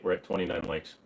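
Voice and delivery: normal talking voice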